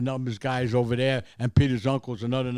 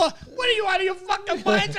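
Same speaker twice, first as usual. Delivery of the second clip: much higher, louder outraged yell; 7 dB louder than usual. Retold or imitated. Bystanders yelling at the gamblers during the raid, loud and high. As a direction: bellow at the fools.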